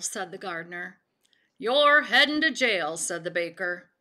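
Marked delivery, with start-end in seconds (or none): 1.6-3.0 loud storybook accusation; acted, much louder scolding; scolding threat. A narrator's reading. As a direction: call it out as a stern threat. Baker confronts the cornered frog in story.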